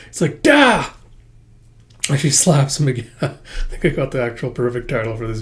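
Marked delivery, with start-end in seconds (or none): 0.4-0.9 much higher, louder sharp cry; mock slap yell. A woman slaps the hero with a shout. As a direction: bark it out as the blow lands.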